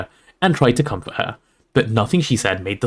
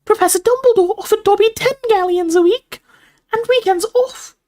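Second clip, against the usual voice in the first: very high elf squeak; earnest house elf; 3 dB louder than usual. A narrator's reading. Dobby reports the first offer, squeaky elf. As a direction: pipe it like a little elf.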